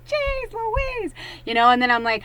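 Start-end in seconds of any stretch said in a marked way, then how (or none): 0.1-1.1 high, squeaky jeez Louise; a cartoonish exasperated squeal. Fed up with Spirit, a high squeaky exclamation.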